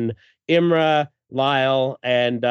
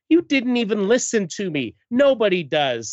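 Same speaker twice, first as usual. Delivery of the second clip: higher-pitched, hammed-up complaint; acted hurt reproach; 8 semitones higher than usual. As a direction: fling it back as a wounded accusation. Acted quarrel in comic.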